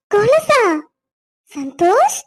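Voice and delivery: touched wife near tears; tearful surprise. Acted. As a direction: voice rising high with tears of joy. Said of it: "இப்படி நீ அழுதுட்டே இருக்காத".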